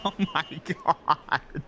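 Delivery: slow, laughed-out exclamation; real helpless laughter stretching the words; laughing disbelief. Real, not acted. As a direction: crack up while saying it. Cracks up at own habit.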